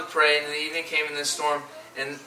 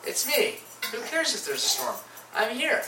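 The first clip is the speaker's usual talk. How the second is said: higher, easy voice of a calm Jesus, rising to a high who cares; Jesus telling scared disciples not to worry, high and breezy; voicing Jesus; 7 semitones higher than usual. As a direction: wave off the storm lightly, voice lifting. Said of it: "He's like".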